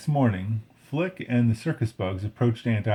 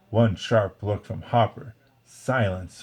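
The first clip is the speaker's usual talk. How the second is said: usual speaking voice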